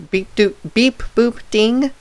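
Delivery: slow, loud robot beeping; robot beeps. Pretend. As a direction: beep it out like a little robot. Imitating game robot beeps.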